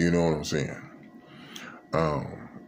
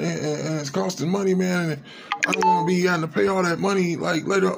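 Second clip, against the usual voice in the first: pleading friend; 8 semitones higher than usual. Retold or imitated. Begging friend for a ride.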